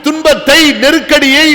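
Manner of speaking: ordinary talk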